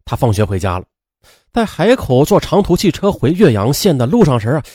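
ordinary delivery, nothing marked

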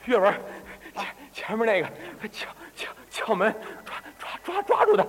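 acted gasping and stammering, words breaking up; 累得喘不上气. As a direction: play an old man too winded to get the sentence out. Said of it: "累的。". (上气不接下气，断断续续地说)

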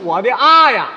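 loud mock lament with a laugh; hammed-up cry of disbelief; mocking wail. Straight man mocks the wail.

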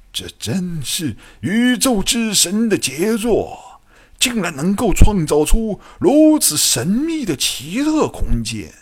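high-pitched, rapturous eccentric doctor; ecstatic wonder. A narrator's reading. Scientist marvelling at a living space, ecstatic wonder. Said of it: "怪博士的心中惊喜若狂".

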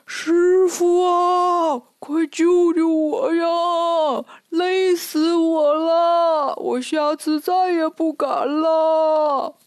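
high, long-drawn wailing cry; calling for help. Acted. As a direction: cry out from afar, stretching every phrase. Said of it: "忽听林子深处有人喊".

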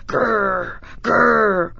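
a storybook growl, lightly done; playful wolf growl for children. A narrator's reading. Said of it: "and he growled".

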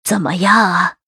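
drawn-in, lightly voiced old matriarch; old lady asking. A narrator's reading. Old lady quizzes the new concubine, eager old matriarch voice. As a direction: ask as the eager old mistress. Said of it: "问着茯苓".

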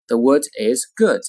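"The wood is good" with "wood" and "good" stressed and held; lengthened sentence stress. Shown as a demonstration. Teacher demonstrating sentence stress, "wood" and "good" lengthened. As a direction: lean on "wood" and "good", keep "The" short. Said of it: "句子重音在哪里".

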